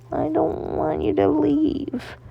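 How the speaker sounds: high, slow pleading little voice; small boy begging his father to stay; pleading child. Acted. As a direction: beg him not to go.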